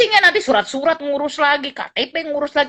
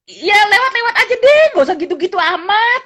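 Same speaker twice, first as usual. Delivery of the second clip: much higher and louder, mocking the over-friendliness; dismissive friend; 10 semitones higher, 5 dB louder than usual. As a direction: play the scornful friend brushing it off. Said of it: "gitu ya, kata teman saya". (teman yang meremehkan, nada tinggi dan keras)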